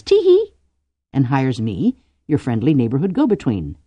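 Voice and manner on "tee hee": very high, loud spoken tee-hee; mock motherly titter. Cordelia plays Ku's ma delighted, mock titter. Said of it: "and Ku's ma says".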